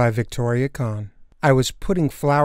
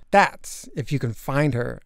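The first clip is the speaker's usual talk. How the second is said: ordinary delivery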